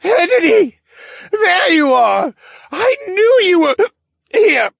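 high, loud, swooning fool; dreamy lovestruck man. A narrator's reading. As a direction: gush at her like a lovesick fool.

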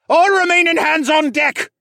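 high, loud captain's command; shouted order. Acted. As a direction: bellow to the whole deck. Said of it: "the raccoon captain ordered".

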